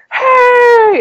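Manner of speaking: high, loud party holler; whooping, high-pitched "hey"; imitated yell. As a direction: holler a greeting to the whole basement. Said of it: "yelling at the".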